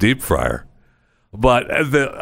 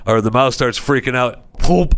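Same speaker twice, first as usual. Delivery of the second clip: ordinary delivery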